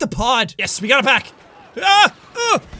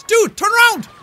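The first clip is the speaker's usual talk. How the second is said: high, loud call to a teammate; urgent call; 8 semitones higher, 3 dB louder than usual. Real, not acted. Gamer yelling at his teammate to turn around, urgent call. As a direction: snap a warning at him.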